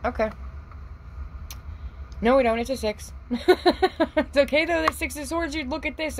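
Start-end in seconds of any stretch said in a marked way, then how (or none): 2.2-6.2 high, bright-voiced excitement over the card; excited squeal. Real, not acted. Excited correction about the card.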